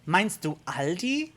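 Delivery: slow question in German. Retold or imitated. Germans respond to a confused tourist, German. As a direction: ask it in native German, puzzled. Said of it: "they're gonna look at you".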